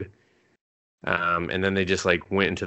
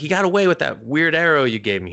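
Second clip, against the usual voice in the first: higher, louder complaint; mock accusing; 7 semitones higher, 4 dB louder than usual. Elf scolding companion about the arrow, mock accusing. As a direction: blame him, half joking. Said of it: "And she's like".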